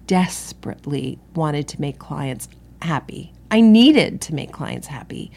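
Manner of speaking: ordinary delivery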